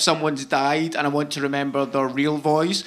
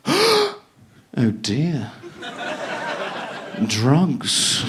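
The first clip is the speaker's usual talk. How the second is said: mock-grave English guard; English accent; much slower than usual. Guard finding oil in a suitcase, put-on English accent. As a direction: put on an English accent, pausing before the last word. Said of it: "I'll just make him English".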